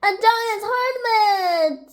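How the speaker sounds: high, slow wailing cry; acted alarm. A narrator's reading. Rabbit finding signs of a giant, high wailed alarm. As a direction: wail it out in mock fright.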